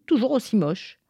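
plain reading voice

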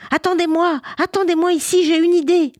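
high, loud excited call; girl's cry. Acted. Little girl calling to her friends to wait, high excited cry. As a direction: call out to stop them. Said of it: "la petite fille cria".

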